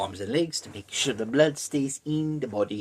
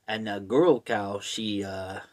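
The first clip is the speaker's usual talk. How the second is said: ordinary talk, nothing marked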